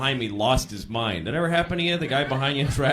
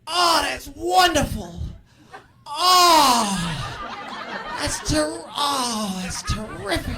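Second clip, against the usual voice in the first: happy for you but in pain; high, drawn-out agonized congratulations; Jerry; 12 semitones higher, much slower than usual. Retold or imitated. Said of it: "sound like they're in pain also".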